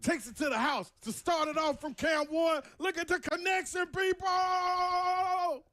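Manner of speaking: rising excited sports call; play-by-play call. Pretend.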